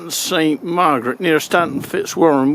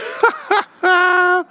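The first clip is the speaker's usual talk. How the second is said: cackling laugh; 10 semitones higher than usual. Real, not acted. Laughs after sign-off.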